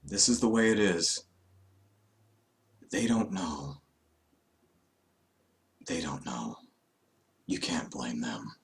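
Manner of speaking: soft, fading to a whisper; resigned calm. Retold or imitated. Sausage soothing his friends as he is carried off, fading soft. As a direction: gently accept his fate. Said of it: "And he's like".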